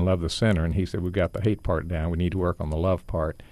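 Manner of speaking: ordinary talk, nothing marked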